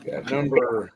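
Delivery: usual speaking voice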